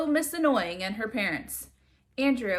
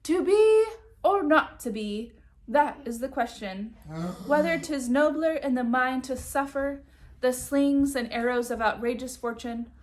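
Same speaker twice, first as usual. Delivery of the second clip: dramatic recital; 3 semitones higher, 3 dB louder than usual. Acted. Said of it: "started reading out loud".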